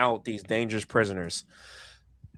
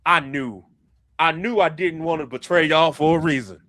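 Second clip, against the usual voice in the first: raised, louder in-character voice; pleased realisation; 6 semitones higher, 6 dB louder than usual. Acted. Pirate captain glad he didn't betray them, raised voice. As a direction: declare it higher and more forcefully. Acted pirate relieved at loyal choice.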